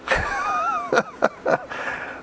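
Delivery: a high wheezy cackle; high cackling laugh. Real, not acted. Veteran laughing at the prank on Gnarls, with a high cackle. Laughing at his own story.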